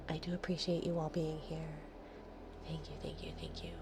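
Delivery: whispered thank you to the viewers; soft whisper. Real, not acted. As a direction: breathe it out as a grateful whisper.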